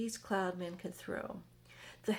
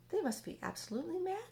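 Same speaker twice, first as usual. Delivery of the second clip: higher, fast and scornful centipede; cheeky centipede; 9 semitones higher, faster than usual. Acted. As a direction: rattle it off like a mocking bug. Centipede voiced in story.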